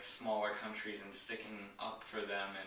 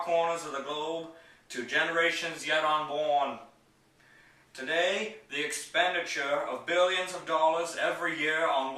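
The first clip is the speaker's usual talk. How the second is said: raised, ringing presidential oratory in measured phrases; higher, louder, declamatory podium delivery; JFK impersonation; 3 semitones higher, 5 dB louder than usual. Acted. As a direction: give a famous speech in an imitated statesman's voice, loud and emphatic, pausing between phrases. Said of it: "going to do my J F K impersonation".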